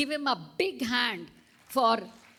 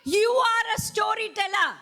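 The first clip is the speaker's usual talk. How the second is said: excited shout; 11 semitones higher, 6 dB louder than usual. Real, not acted. Teacher cueing class, laughing.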